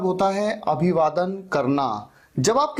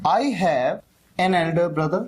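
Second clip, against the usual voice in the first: slow model sentence; 2 semitones lower, much slower than usual. Shown as a demonstration. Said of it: "तो आप कहते हैं".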